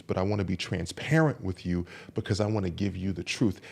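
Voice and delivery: soft, low, controlled admission; tempered honesty. Shown as a demonstration. Man communicating his emotional state to someone, soft and tempered. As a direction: own the feelings quietly, without volatility. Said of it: "in, with temperance".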